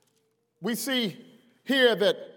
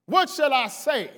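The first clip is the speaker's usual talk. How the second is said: usual speaking voice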